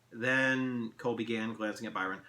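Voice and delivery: ordinary delivery, nothing marked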